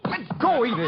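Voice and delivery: high, loud struggling cry; straining shout. Acted. Archie wrestling the killer, straining shout. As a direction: force it out mid-fight.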